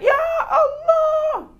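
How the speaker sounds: high, drawn-out crying scream; wife's scream. Retold or imitated. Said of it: "istrinya teriak". (jeritan istri yang panjang dan tinggi)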